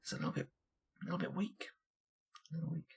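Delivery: very quiet, almost to yourself; hushed aside. Real, not acted. Player to himself on his weak damage, hushed. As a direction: drop right down to a small mumble.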